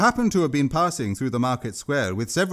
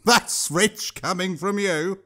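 slow, higher scornful retort; old woman retort; 6 semitones higher, slower than usual. A narrator's reading. Old woman fires back at the troll. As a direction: drag it out with scorn. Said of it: "the old woman said".

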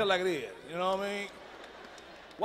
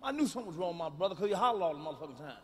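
normal talking voice

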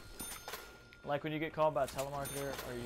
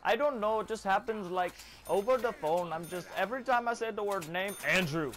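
put-on higher Indian-accented agent explaining; mock Indian accent; 4 semitones higher, 3 dB louder than usual. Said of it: "they're just like".

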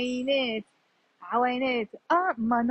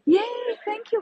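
high-pitched happy cheer; delighted; 10 semitones higher, slower than usual. Real, not acted. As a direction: cheer and thank with relief. Delighted the camera trick worked.

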